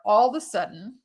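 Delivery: ordinary talk, nothing marked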